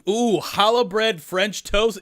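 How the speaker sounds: drawn-out, delighted craving; delighted ooh. Real, not acted. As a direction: savor the idea out loud, slowly. Delighted by a food suggestion.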